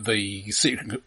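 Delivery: usual speaking voice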